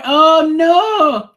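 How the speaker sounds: sudden high, loud "oh no"; shocked cry. Real, not acted. Reacting to a character's fall, a loud high shocked cry. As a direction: blurt it out in real shock.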